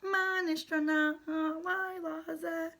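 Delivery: high, loud, slow singing parrot; squeaky sung parrot echo, held long. A narrator's reading. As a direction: squawk the chant back like a parrot. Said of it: "repeated in his squeaky parrot voice".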